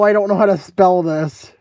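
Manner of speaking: normal talking voice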